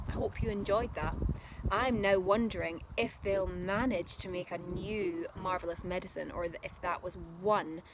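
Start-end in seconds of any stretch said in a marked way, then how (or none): none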